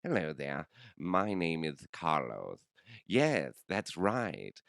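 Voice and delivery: slow, hammed-up British accent. Podcaster testing a character called Carlos, slow British accent. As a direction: introduce yourself in a drawn-out British voice. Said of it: "I was doing a British accent".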